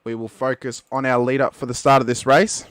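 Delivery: ordinary talk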